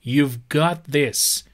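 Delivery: very slow, stress on got; slow model phrase. Shown as a demonstration. Teacher presenting a slang phrase to students, very slow. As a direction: give it slowly for learners. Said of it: "You've got this که توی اسلنگ".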